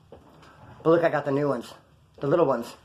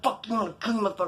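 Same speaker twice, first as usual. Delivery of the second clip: high, held-down mad voice; strained mock fury, kept quiet; imitated anger; 4 semitones higher, 5 dB quieter, faster than usual. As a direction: act out a furious choker without volume. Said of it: "he looked mad as fuck".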